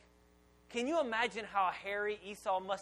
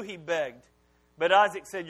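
normal talking voice

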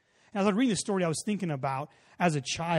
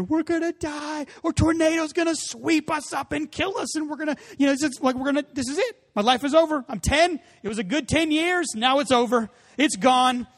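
high-pitched, mock-panicked rush; imitating a scared kid sure he'll die; childlike panic; 10 semitones higher, 5 dB louder than usual. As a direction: replay a ten-year-old's terror. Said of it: "instant panic".

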